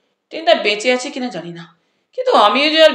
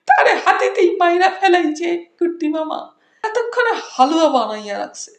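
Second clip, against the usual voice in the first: wailing Habul; 8 semitones higher than usual. A narrator's reading. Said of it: "হাউমাউ করে কেঁদে উঠল হাবুল".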